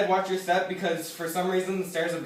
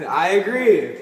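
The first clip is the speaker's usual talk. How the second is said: laughing; 11 dB louder, slower than usual. Real, not acted. Laughs at turtle bet.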